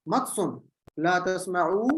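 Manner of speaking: ordinary talk, nothing marked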